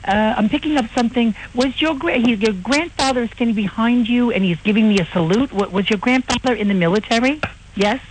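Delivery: high, sing-song psychic medium voice; a campy medium reading the room; hammed-up psychic claiming a spirit is there; mock psychic.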